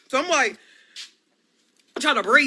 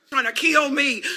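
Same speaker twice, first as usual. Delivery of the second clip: ordinary talk, nothing marked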